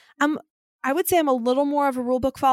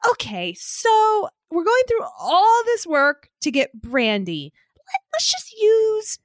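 slow, higher-pitched put-upon husband; mock exasperation; 3 semitones higher, slower than usual. Husband questioning a brandy errand, mock exasperated. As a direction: imitate a partner straining to stay patient. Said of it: "I could just tell there was a thing".

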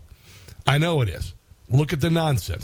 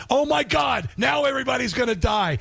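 mock panic; 9 semitones higher than usual. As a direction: fake alarm to make people laugh. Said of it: "I says".

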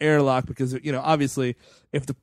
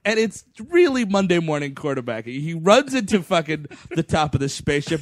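ordinary talk, nothing marked

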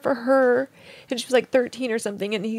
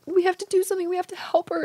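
higher, pressing urgency; urgent plea; 7 semitones higher, faster than usual. Retold or imitated. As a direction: push to save her, pitch raised. Urgent plea in retold dream.

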